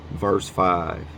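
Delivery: ordinary talk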